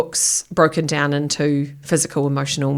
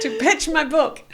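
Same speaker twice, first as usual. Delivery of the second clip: high laughing echo; 11 semitones higher than usual. Real, not acted. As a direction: repeat it while laughing. Guest laughs repeating the request.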